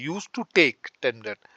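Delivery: slowly laid out, word by word; slow dictation. Shown as a demonstration. Teacher slowly models the past habit sentence for learners, deliberate. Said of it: "past की बात है".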